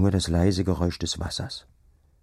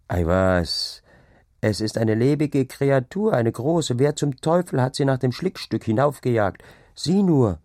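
raised, higher voice with a touch of dread; uneasy bravado; 6 semitones higher, 3 dB louder than usual. A narrator's reading. Farmhand laughs off the strange sight to the day labourer, uneasy bravado. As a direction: brush it off loudly, mildly uneasy. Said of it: "wenn auch ein Grauen bei ihm hindurchklang".

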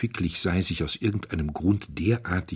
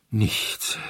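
weighty, breath-heavy single word; deep emphasis; 2 semitones higher than usual. A narrator's reading. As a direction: let it drop gravely. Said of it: "mit tiefem Nachdruck". (mit tiefem Nachdruck)

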